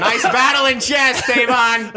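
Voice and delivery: high, drawn-out full shout; mock shouting. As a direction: shout it slowly across the cabin, hamming it up. Jokingly re-enacting the plane yell.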